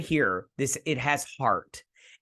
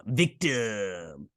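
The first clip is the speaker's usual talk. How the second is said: drawn-out, hammed-up VICTIM; mock-menacing, lingering delivery of "victim"; theatrical villain; 2 semitones lower than usual. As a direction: stretch "victim" out like a stage villain. Repeats the word theatrically.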